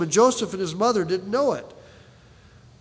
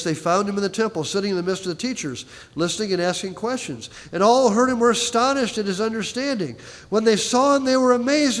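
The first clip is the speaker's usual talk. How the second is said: normal talking voice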